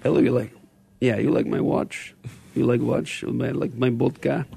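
put-on joking Russian accent, half-hearted; mock Russian accent. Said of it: "my Russian accent".

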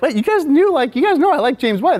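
high, loud mock disbelief; incredulous. Confronting friends who hid the bad review, hammed-up incredulity. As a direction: exclaim it in hammed-up astonishment.

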